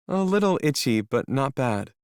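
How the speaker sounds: put-on sniffly young woman character. Melody's voice.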